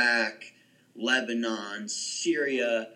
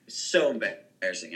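normal talking voice

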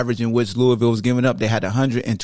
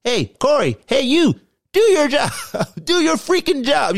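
much higher mock yell at the player; mock coach yelling; 13 semitones higher than usual. Host imitating the coach yelling at his guard, much higher. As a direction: bark it like an angry coach. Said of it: "what did Coach Bennett say to him".

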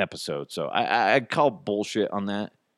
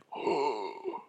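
soft, drawn-out gasp; re-enacted gasp of surprise; shocked gasp; 5 semitones higher, 10 dB quieter than usual. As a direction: suck in breath in astonishment. Retelling his shock at normal voice.

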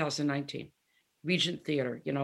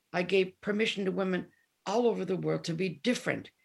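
ordinary talk, nothing marked

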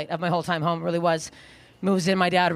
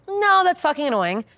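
higher, mock-irritated retort; annoyed; 6 semitones higher, 4 dB louder than usual. Arguing back to mom and sister, mock annoyed. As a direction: act out stubborn annoyance. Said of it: "get annoyed by something".

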